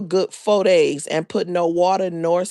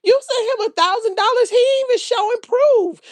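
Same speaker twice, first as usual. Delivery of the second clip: mock disbelief; 4 dB louder than usual. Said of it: "I'm like".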